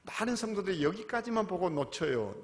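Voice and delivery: ordinary delivery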